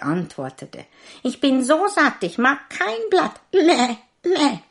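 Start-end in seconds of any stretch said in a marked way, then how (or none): 1.2-4.6 high, loud goat voice bleating Meh; a smug goat bleating its lines. A narrator's reading. Goat answering the tailor in a high bleat.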